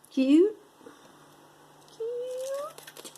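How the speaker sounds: very high, squealed "cute"; squeaky high. Pretend. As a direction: squeal it like something adorable. Squealing over cute bracelet.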